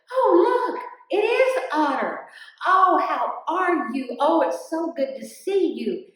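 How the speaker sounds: high, loud, gushing greeting; delighted welcome. Acted. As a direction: greet a long-missed friend with big excitement. Animals joyfully greet supposed Otter.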